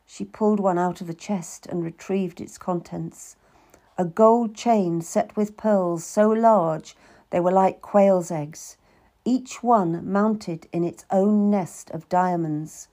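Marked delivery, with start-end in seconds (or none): none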